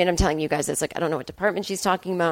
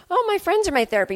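mock breezy claim; 10 semitones higher, faster than usual. Mocking a friend who overshares.

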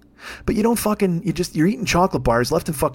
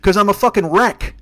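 normal talking voice

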